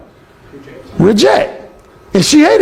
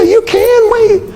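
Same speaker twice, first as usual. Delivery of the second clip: much higher, slow, coaxing woman's voice; roommate insisting; 13 semitones higher, slower than usual. Retold or imitated. Alan imitating the roommate urging him to stay, high and slow. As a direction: stretch it out in a high girly coax.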